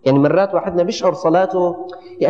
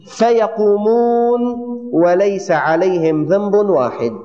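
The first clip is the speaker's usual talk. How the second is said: drawn-out promise; 2 semitones higher, slower than usual. Acted. Preacher stretches the promise.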